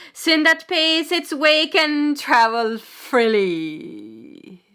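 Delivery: preacher's declamation. Acted. Preacher warning shipmates about sin, loud slow declamation. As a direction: draw each word out at full voice.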